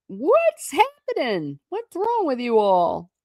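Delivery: high, startled housekeeper calling out; a lightly voiced alarmed housekeeper. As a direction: burst in asking what the fuss is.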